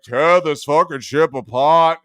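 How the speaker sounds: loud villain command; Darth Vader. Retold or imitated. Villain ordering a search of the ship, loud impression. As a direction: order the troops with force. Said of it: "like Darth Vader asking for the plans".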